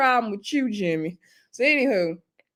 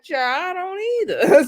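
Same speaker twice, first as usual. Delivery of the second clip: high-pitched comic bafflement; exasperated agreement; 8 semitones higher than usual. Retold or imitated. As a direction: throw it up high, amused and baffled. Her amused aside on their argument.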